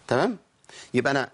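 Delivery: normal talking voice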